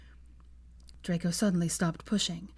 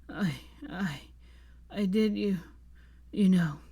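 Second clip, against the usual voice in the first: stuttering hoarsely, halting. A narrator's reading. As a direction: stammer with a rough voice. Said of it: "he stuttered hoarsely then".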